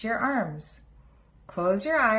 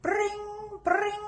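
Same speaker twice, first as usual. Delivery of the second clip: high, sung bring bring like a doorbell; much higher ringing doorbell call; 13 semitones higher than usual. A narrator's reading. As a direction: imitate a cheerful doorbell. Doorbell sound imitated.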